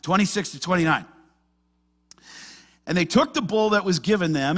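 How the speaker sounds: usual speaking voice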